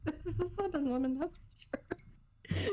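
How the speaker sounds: high, loud and drawn-out brag; proud exclamation. Real, not acted. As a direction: crow about it, stretching the last words. Proudly claiming Southern roots.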